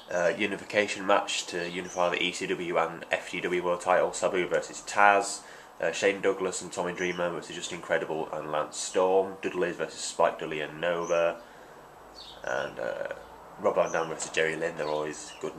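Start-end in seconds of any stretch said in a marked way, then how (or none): none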